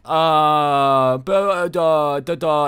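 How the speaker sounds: mock tongue-tied stammering; a flustered boyfriend stammering with no answer; speechless stammer. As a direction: fumble for words, caught out. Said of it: "He's just speechless".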